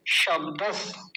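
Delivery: शब्दस said slowly, stopping after the final स; slow split word. Shown as a demonstration. Teacher showing where to break the word, slowly. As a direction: pause after शब्दस with a half स. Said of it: "क्योंकि यहाँ रुक जाएँगे".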